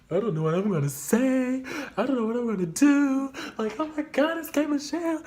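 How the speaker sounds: high, loud flustered panic; starstruck panic. Retold or imitated. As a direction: spiral into nervous fan panic. Freezing before meeting idol.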